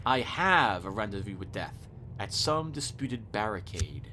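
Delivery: slow, low, solemn recitation; poem recital. Acted. A remembered poem about Death, slow low recital. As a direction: intone the verse gravely.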